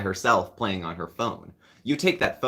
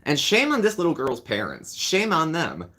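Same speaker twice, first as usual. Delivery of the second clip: higher, indignant shame on them; righteous anger; 7 semitones higher than usual. Real, not acted. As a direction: scold the parents hotly. Condemning the parents.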